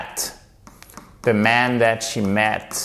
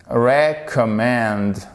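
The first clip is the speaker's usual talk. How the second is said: recommend, slow, leaning on the first syllable; slow teaching stress on recommend; much slower than usual. Shown as a demonstration. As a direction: stretch out recommend and stress it at the start. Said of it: "Vous avez votre accent tonique ici".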